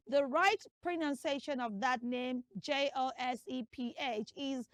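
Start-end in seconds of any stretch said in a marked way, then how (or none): none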